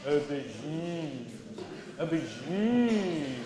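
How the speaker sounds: slow, drawn-out French accent. Shown as a demonstration. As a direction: stretch it out in a low, showy French accent. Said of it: "pronounce it with a French accent".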